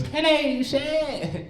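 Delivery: high-pitched mock British hello; British accent. Stranger approaching a tourist in Times Square, mock British accent. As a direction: imitate an over-the-top British stranger. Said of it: "if you guys know any British people".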